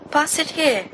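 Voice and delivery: high, eager stoned voice; a lightly voiced Peter reaching for the joint; a hint of eager Peter. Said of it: "Peter says".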